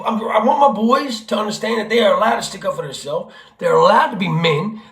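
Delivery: normal talking voice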